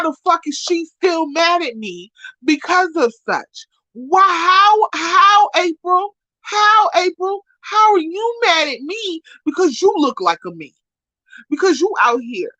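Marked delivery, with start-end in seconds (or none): 3.9-10.7 1 exasperated outburst. Real, not acted. Exasperated at April, high outburst.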